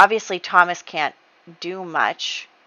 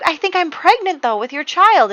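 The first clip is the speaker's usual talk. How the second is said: anxious confession; 11 semitones higher, 6 dB louder than usual. Acted. Francesca confesses pregnancy.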